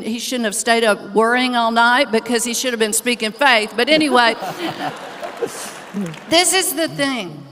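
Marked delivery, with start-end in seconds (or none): 4.4-6.2 a soft airy laugh; a light, unvoiced chuckle; real breathy laugh. Real, not acted. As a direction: laugh quietly at the story. Laughs after her story.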